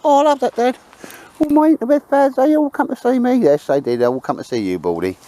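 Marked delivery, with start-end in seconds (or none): 0.0-3.3 much higher, louder put-on dog voice; voicing the dog. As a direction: speak for the dog in a squeaky high voice.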